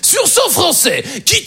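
high, slow, sing-song TV host voice; high sing-song TV host on the phone; Jean-Luc Reichmann impression. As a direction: croon it like a game-show host. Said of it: "faire Jean-Luc Reichmann".

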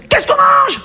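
very high, sudden cry for dinner; peremptory demand. Acted. Husband of old home from work demands dinner, sharp high call. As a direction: call it out like an order.

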